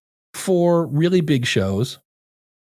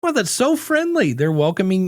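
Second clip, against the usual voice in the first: high, sing-song pleased surprise; mock delighted; 6 semitones higher than usual. A shopper pleased by the Walmart greeter, mock delighted. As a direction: gush as if charmed by a greeter. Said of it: "And you're like".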